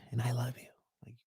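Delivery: whispered sweet nothings; doting whisper. Retold or imitated. Doting partner forgiving bae, whispered lovingly. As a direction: whisper it adoringly.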